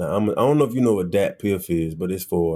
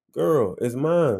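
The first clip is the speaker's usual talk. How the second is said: slow, higher, insistent retort; 4 semitones higher, slower than usual. Retold or imitated. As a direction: draw it out, mildly exasperated. Repeats answer to disbelieving friend.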